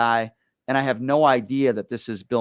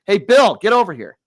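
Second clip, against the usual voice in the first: loud call across the room to his uncle; 9 semitones higher, 10 dB louder than usual. Retold or imitated. Nephew hailing Bill Murray from five feet, loud call. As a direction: shout him over, high and loud.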